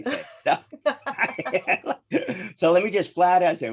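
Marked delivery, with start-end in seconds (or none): 0.6-2.5 high laughing outburst; burst of laughter. Real, not acted. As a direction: laugh it out, unable to hold back. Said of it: "without laughing".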